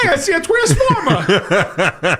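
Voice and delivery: high, slow, laughing Wahlberg voice; Wahlberg impression. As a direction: imitate Wahlberg with a Boston accent. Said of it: "give me your Mark Wahlberg".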